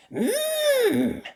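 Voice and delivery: very high hum of delight; appreciative mmm. Acted. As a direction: savour the smell. Molly enjoys smell.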